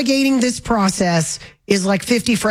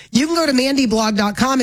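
ordinary talk, nothing marked

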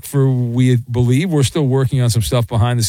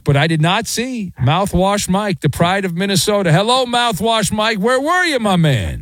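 hyped-up welcome; 6 semitones higher than usual. Acted. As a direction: roll out the red carpet for the caller. Host hypes the caller's entrance.

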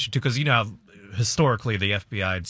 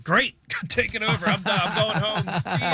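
high, breezy hand-off with a laugh; gladly dump the job and leave; relieved local cop; 8 semitones higher than usual. Acted. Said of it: "if I were the local cops, I'd be like".

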